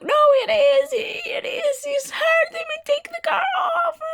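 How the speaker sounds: high-pitched, loud pleading shriek; imitated screaming. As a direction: mimic dad screaming that it hurts. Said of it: "he starts screaming like".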